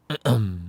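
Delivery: imitated awkward throat-clear. Visitor sits down facing the mother, imitated throat-clearing. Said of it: "하고 딱 앉아있는데".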